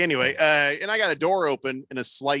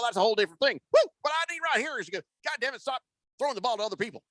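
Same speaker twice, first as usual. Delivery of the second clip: much higher, excited folksy team owner; Jerry Jones impression; 8 semitones higher, faster than usual. Jerry Jones on TV asked about Dak Prescott, much higher folksy ramble. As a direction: ramble like a fired-up old Texan owner. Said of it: "He's like".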